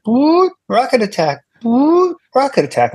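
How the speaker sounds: high, calm recorded warning voice; British lady alarm voice. Retold or imitated. Comedian recalling the alarm mid-show, British lady voice. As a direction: mimic the pleasant alarm announcer. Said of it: "this British lady's voice".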